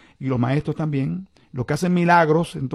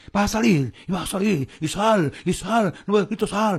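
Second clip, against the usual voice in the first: imitated exorcism shouting; 5 semitones higher than usual. Host mimics healers ordering out a demon, mock shouting. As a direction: imitate healers yelling at a demon in short bursts. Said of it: "empiezan a gritarle".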